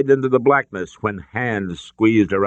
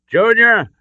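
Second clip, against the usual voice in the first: high, loud desperate shout; father shouting to his son at the cliff edge; 11 semitones higher, 5 dB louder, slower than usual. A narrator's reading. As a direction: yell his son's name in alarm. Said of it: "Henry shouted".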